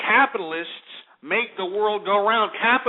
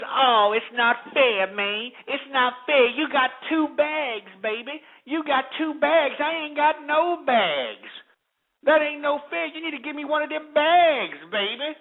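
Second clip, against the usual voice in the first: high, slow, put-on whining voice; mock the complaint in a high drawn-out whine; mocking whiny worker; 8 semitones higher than usual. Host mimicking lazy service workers complaining, high mock whine. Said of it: "why are they giving piss poor service".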